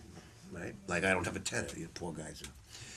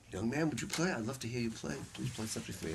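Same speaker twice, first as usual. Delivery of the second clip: kindly request; 7 dB quieter than usual. Retold or imitated. Bird asking young Phil to play for him, kindly. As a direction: coax softly, fading as you go.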